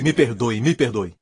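ordinary delivery

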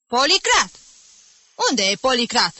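high, loud call across the classroom; shouting. A narrator's reading. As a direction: call out sharply for a missing pupil. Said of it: "strigă ostrogotul".

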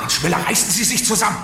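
viel höher und lauter; scharfe Zurechtweisung. Acted. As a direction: genervt anfahren. Marlow weist Müller zurecht. (Marlow fährt den panischen Müller an)

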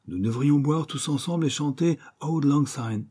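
high, eager proposal to the table; excitable dinner speaker; excited toast. A narrator's reading. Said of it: "Ainsley, très excité".

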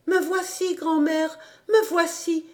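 little Heidi calling to her grandmother; girl exclaiming with joy; cried out. Acted. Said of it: "s'écria alors Heidi".

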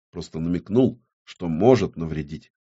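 ordinary delivery, nothing marked

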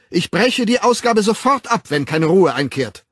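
loud, high-pitched threat; threatening. A narrator's reading. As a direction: threaten the unruly crowd at full voice. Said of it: "Die Drohung zeigte Wirkung". (laut drohend)

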